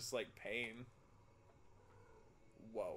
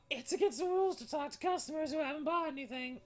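high raspy lizard voice, higher pitched; squeaky strained lizard clerk; 11 semitones higher than usual. Acted. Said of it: "I was doing the lizard voice".

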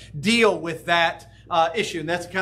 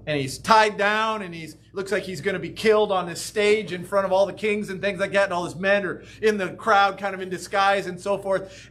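usual speaking voice

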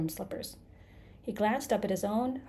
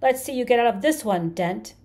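high, very loud taunt; scream; 5 semitones higher, 10 dB louder than usual. A narrator's reading. Creature dares Arthur to escape, screaming. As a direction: scream the challenge at full force. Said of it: "he screamed".

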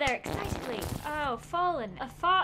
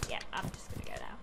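whispered, low and quick; embarrassed whispered exit; sheepish whisper; 10 dB quieter, faster than usual. Real, not acted. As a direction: slink away whispering. Sneaking off after knocking frog.

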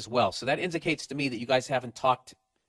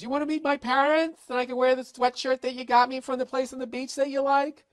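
put-on high, sweet falsetto girlfriend; mock girlfriend voice; 12 semitones higher than usual. Podcaster playing the girlfriend asking him to meet her parents, high falsetto. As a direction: squeak it out like a smitten girlfriend. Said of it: "when she goes".

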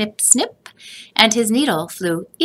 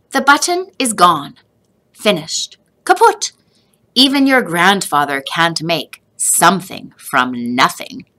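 a mother closing the matter; firm mother; 4 dB louder than usual. A narrator's reading. Mother tells Joseph the button is gone for good.

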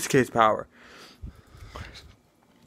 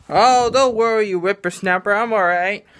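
put-on raised, creaky old master brushing off concern; old man voice; 6 semitones higher, 3 dB louder than usual. Master Roshi reassuring Kuwabara, in a higher old man voice. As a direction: play the old hermit waving him off.

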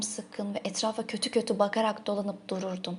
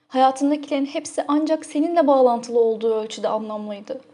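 louder, swelling declaration of devotion; impassioned, raised voice; fervent confession; 2 semitones higher, 7 dB louder than usual. Acted. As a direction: press each phrase with mounting passion.

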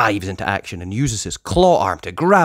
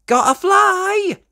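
much higher, louder, slow; spunky girl pup; 6 dB louder, slower than usual. Acted. Sky heads off after the drone, high and eager. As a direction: take off eagerly. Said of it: "says Sky".

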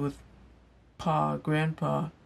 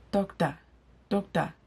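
higher-pitched Igbo-accented "doctor", ending like "dok-tah"; Nigerian Pidgin accent; 4 semitones higher than usual. Shown as a demonstration. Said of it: "saying it with the right accent".

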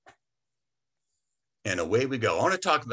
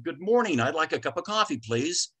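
higher, courteous coffee order; polite, friendly tone; 5 semitones higher than usual. Acted. Customer walking into the coffee store to order, polite and friendly. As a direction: greet cheerily and lift on please. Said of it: "in that tone of voice".

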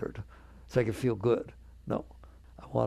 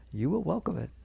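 ordinary delivery

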